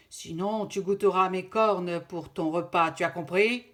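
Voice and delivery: loud furious threat; angry threat. Acted. Father threatening the lynx, loud and angry. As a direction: bark the threat at him. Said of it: "très en colère".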